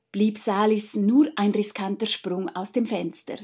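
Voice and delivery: ordinary delivery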